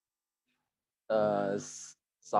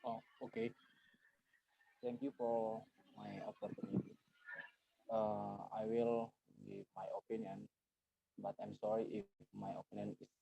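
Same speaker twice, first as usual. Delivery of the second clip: too quiet to hear; 12 dB quieter than usual. Real, not acted. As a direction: speak softly, barely audible to the listeners. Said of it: "I couldn't hear you".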